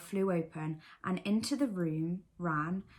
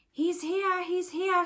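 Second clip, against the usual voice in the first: high, louder excited squeak; 11 semitones higher, 6 dB louder, slower than usual. A narrator's reading. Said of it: "squeaked Rabbit".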